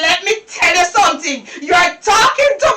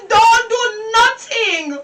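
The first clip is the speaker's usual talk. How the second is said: normal talking voice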